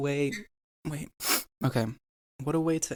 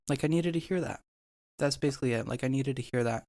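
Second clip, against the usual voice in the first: words breaking up through tears; crying. Real, not acted. Moved podcast guest admitting he needed it, tearful. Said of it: "Don't cry".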